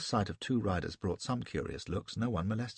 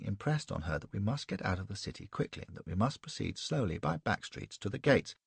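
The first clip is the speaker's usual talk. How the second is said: plain reading voice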